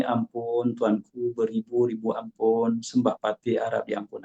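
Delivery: soft, reverent plea to a king; hushed, respectful servant before the sultan; humble and proper. Shown as a demonstration. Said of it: "dengan nada yang sesuai".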